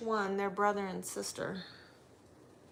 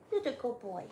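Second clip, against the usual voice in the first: talking to a dog; 3 semitones higher than usual. Real, not acted. Praising dog.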